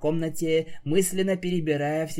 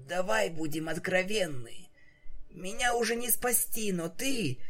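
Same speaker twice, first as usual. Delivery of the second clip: wheezing; 7 semitones higher than usual. Acted. Dying pegasus begging her friend, hoarse and weak. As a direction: rasp out through sickness. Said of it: "хрипела Рейнбоу".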